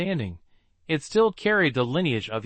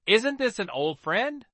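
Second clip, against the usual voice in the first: amused; 8 semitones higher than usual. Acted. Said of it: "immediately burst into laughter".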